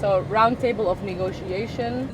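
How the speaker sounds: ordinary talk, nothing marked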